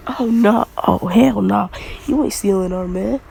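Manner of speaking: loud, sassy, hands-on-hips protest. Retold or imitated. Mina warning off a rival girl, loud and sassy. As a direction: puff up and tell her off.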